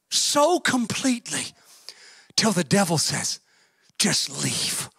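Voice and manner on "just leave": low, soft, worn-down dismissal; defeated plea. Acted. The devil gives up on an obedient believer, defeated. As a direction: drop low and quiet, utterly done with them.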